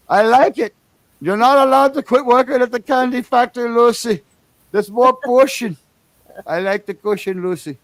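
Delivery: hammed-up Cuban husband; Cuban accent. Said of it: "I'm a crazy Cuban".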